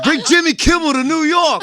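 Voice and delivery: high mock New York heckle; heckling New York accent. Jaded locals demand another host, high mock New York accent. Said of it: "why I do that accent".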